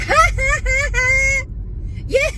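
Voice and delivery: very high, loud mock cackle; imitated outburst of laughter. Imitating the siding guy busting out laughing, mock cackle. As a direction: mimic someone bursting out laughing. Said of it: "he just busts out laughing".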